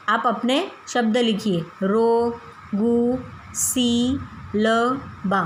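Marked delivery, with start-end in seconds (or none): 1.8-5.4 slow syllables. Shown as a demonstration. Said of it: "teacher reading syllables".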